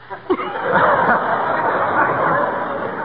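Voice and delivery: long high breathy laugh; hearty laugh. Real, not acted. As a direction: crack up laughing. [name] laughs after "Oh, sailor.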